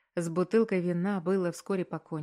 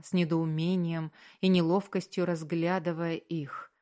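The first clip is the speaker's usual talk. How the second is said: plain reading voice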